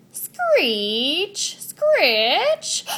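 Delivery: high loud drawn-out screech; spooky rising screech; eerie screech sound. Acted. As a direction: wail the screech like a ghost noise. Imitating a scary noise.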